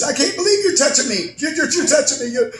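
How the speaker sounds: very high, breathless starstruck squeal; starstruck woman. Retold or imitated. Dazed fan helped up by Johnny, very high and gushing. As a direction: gush like a fan who can't believe it. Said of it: "she says".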